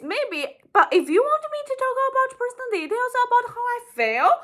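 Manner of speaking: high, fast, breezy dodge of feelings; hammed-up voice of someone dodging feelings; mock avoidant person. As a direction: play the person brushing off emotions, quick and high-pitched. Mimics avoidant person's inner voice.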